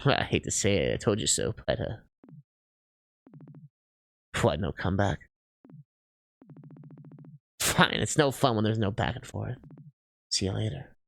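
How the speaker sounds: very low, gloating voice; taunting figure. Acted. Onlooker gloats over the silent loser, low and taunting. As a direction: needle them smugly, low and unhurried.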